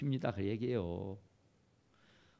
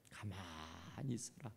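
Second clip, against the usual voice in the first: slow, much lower and softer command to be still; Moses calming the people; 12 semitones lower, 17 dB quieter, slower than usual. Retold or imitated. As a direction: hush the frightened crowd gently. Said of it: "모세가".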